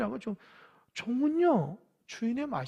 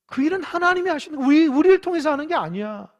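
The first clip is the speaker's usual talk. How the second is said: loud and higher, full force; preaching shout; 7 semitones higher, 12 dB louder than usual. Real, not acted. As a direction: proclaim it at the top of your voice. (힘차게 외치는 설교)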